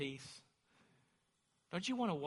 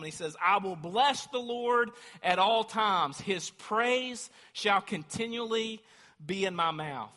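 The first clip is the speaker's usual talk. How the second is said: full-force preaching; 5 semitones higher, 8 dB louder than usual. Acted. Preacher reading Psalm thirty-four to church, loud fervour. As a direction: proclaim it to the rafters.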